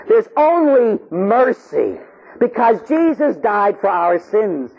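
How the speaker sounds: high, drawn-out proclamation; soaring, fervent preacher's declaration; impassioned preaching. Acted. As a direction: proclaim it to the congregation, stretching key words. Preaches at full force.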